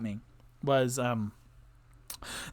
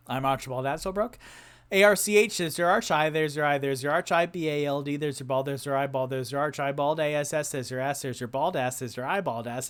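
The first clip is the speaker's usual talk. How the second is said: in one breath. Shown as a demonstration. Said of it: "do it in one breath".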